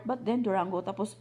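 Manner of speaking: usual speaking voice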